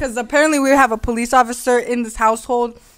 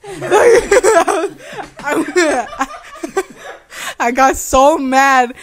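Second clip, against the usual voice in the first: laughing; 5 semitones higher, 4 dB louder, much slower than usual. Real, not acted. Laughs while retelling anger.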